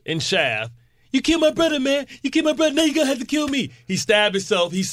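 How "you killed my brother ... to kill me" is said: put-on high-pitched movie villain outburst with Spanish accent; hammed-up, high Spanish-accented movie quote; acting out Peoples. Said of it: "He played a Spanish guy".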